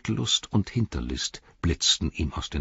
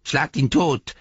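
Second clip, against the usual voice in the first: mocking imitation; 8 semitones higher, 4 dB louder, slower than usual. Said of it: "äffte er sie nach".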